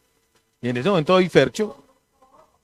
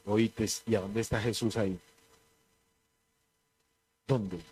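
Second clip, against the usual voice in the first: a low, much softer muttered retort; muttered aside; 9 semitones lower, 13 dB quieter than usual. Retold or imitated. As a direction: drop the voice right down, half to yourself. His muttered reply to believers. (réplica murmurada, mucho más baja)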